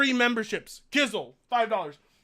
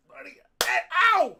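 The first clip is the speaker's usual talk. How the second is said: usual speaking voice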